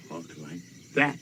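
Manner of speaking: quick low follow-up showing the proper way; a lightly voiced father instructing; quoting his father. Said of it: "My daddy said".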